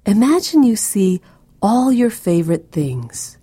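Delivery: usual speaking voice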